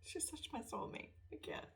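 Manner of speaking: soft, breathless whisper, too moved to go on; overwhelmed whisper. Retold or imitated. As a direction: whisper it as if your heart's too full. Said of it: "And I was like".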